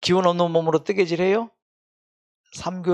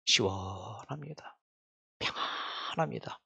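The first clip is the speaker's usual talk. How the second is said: peaceful relief; 6 semitones lower, 10 dB quieter, slower than usual. Acted. Describing newfound peace.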